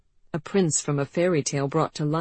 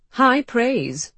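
dry; 8 semitones higher, 6 dB louder than usual. Acted. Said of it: "said Georgie dryly".

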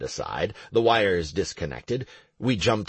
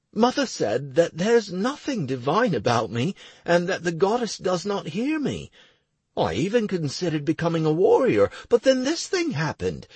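put-on higher, lively young priest; young man voice; 9 semitones higher than usual. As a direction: voice Clowder up and eager. Clowder continues.

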